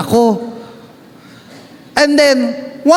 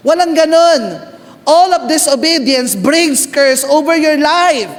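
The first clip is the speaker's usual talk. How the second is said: higher, emphatic sermon voice; preaching; 5 semitones higher than usual. Acted. Preacher warns disobedience brings a curse, fervent and emphatic.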